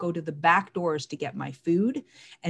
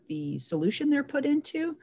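ordinary delivery

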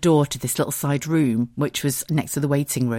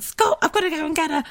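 excited rush. Retold or imitated. Vet calls, dog wants home.

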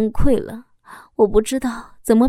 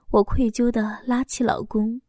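ordinary delivery

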